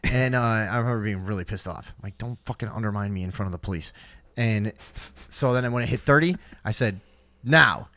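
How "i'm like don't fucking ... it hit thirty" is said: usual speaking voice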